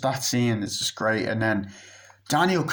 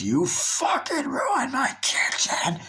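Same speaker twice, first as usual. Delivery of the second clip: mock creepy scream; much slower than usual. Maid screaming at him.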